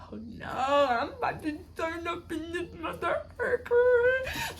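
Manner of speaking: high, drawn-out comic wail; mock outraged wail. Passenger reacting to agent's headphones, hammed-up outraged wail. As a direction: stretch it out high, hamming up the outrage.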